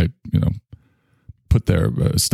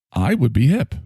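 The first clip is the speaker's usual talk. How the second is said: ordinary delivery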